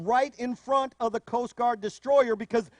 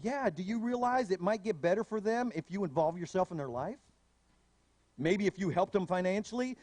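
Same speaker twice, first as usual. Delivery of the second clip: ordinary delivery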